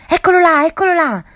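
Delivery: high, loud cry of alarm; terrified shout. A narrator's reading. Mac shouts to Jack, spotting the beast, terrified yell. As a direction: yell it out, pointing in terror. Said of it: "gridò Mac".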